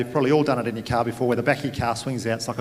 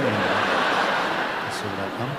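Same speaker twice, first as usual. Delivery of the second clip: laughing yeah; 5 semitones lower, much slower than usual. Retold or imitated. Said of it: "I was like".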